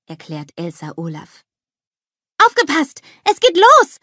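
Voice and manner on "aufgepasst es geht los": loud, very high excited call. A narrator's reading. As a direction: shout the announcement to everyone. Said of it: "ruft Anna".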